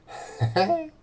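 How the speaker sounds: high, bright mock laugh; giddy laugh. Shown as a demonstration. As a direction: laugh like you're overjoyed.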